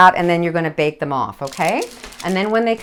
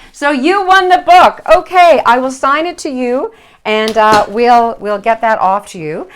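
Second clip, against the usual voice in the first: much higher and louder, thrilled for the winner; real excitement telling a viewer she won; excited announcement; 4 semitones higher, 8 dB louder than usual. Real, not acted. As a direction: burst out with the good news.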